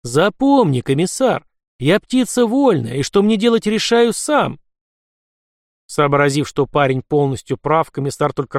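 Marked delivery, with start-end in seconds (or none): 0.0-4.6 firm defiant. A narrator's reading. Lad defies the commissar.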